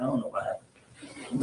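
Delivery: soft, slow, laughing denial. Retold or imitated. Telling the mechanic he doesn't know what happened, laughing softly. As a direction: play dumb through a laugh.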